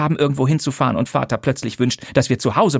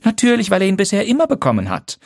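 dry; 11 semitones higher, faster than usual. A narrator's reading. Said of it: "sagte Lydia trocken".

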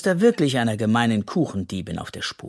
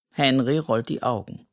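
plain reading voice

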